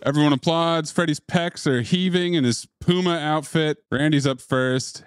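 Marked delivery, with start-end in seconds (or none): none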